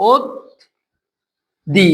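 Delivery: "ওদ", long stop, then "দি"; split syllables. Shown as a demonstration. Teacher showing the doubled consonant, "ওদ" and "দি" said apart. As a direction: sound the d twice, pausing between "ওদ" and "দি". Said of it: "ওদ বলে থামছি, তারপর আবার দ দিব".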